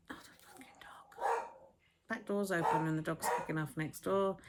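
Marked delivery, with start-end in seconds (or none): 0.1-1.5 hushed, slow annoyed whisper; whispered curse. Real, not acted. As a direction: curse under the breath at the dog. Dog barking interrupts, muttered aside.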